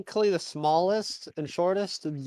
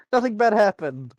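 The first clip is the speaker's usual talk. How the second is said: louder mocking repeat; sarcastic echo of a friend; 5 semitones higher, 6 dB louder than usual. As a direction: throw the words back with a sneer.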